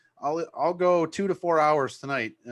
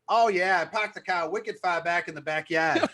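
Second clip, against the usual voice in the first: put-on Boston accent; 3 semitones higher than usual. DJ mimics his own Boston accent in Michigan. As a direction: ham up a Boston local, wicked and all. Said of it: "you start talking like".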